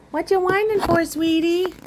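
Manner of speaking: a cooing voice for a whining cat. Real, not acted. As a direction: coo it up high to the pet. Baby talk to the cat.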